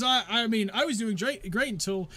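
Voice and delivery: a lightly voiced, put-out merchant; chirpy shopkeeper.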